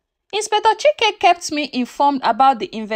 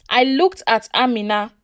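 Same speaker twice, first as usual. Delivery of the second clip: plain reading voice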